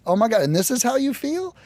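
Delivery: stunned disbelief. Retold or imitated. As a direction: react as if you can't believe your ears. Retold shocked reaction.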